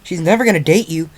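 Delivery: high, loud exasperated outburst; snapping. A narrator's reading. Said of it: "Rainbow Dash snapped".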